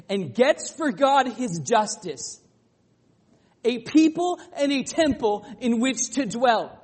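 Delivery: preaching at full force. Acted. Pastor to congregation, preaching the I-am sayings at full force. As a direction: proclaim each claim to the back row. Preacher rises again on justice.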